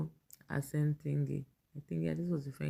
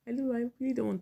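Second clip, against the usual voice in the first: laughing; 8 semitones higher, faster than usual. Real, not acted. Laughing at the post.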